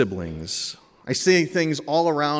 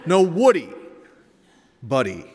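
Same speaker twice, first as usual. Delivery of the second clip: acted sibling bickering, building from insistent to high and loud; escalating squabble; 7 semitones higher, 6 dB louder than usual. Father acting out two bickering sons, escalating squabble. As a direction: trade the words back and forth, rising each time.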